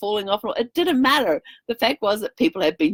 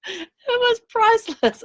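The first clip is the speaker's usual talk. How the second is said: laughing; slower than usual. Real, not acted. Laughing at the kid's reaction.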